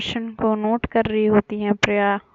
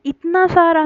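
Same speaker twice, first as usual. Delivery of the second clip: surprised; 8 semitones higher, 5 dB louder than usual. Acted. Surprised at so much food.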